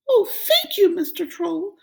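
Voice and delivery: high, grateful goat voice; little goat thanking the troll; relieved little goat. Acted. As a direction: thank him fast.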